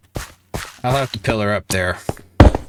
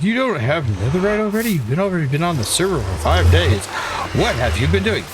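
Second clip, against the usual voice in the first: mocking tryhard; 7 semitones higher, faster than usual. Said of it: "You're like".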